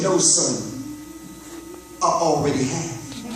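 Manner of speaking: deep, gentle voice of God; low and forgiving, let it settle. Acted. God forgiving the repentant son, deep and gentle. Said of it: "He said".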